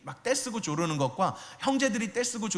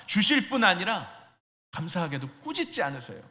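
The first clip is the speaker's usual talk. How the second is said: usual speaking voice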